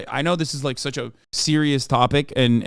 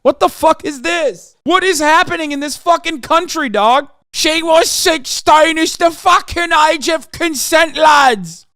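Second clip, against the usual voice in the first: mock British shout; 12 semitones higher, 7 dB louder, slower than usual. Mocking British lads, shouting.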